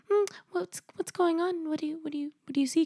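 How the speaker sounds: high, polite little singsong; imitated patience. Mom who can't see anything asks, patiently. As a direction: tiptoe in with a high, softening question. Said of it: "Like, she's very patient".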